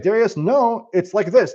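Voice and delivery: high insistent no; insistent correction. Retold or imitated. Second person contradicting.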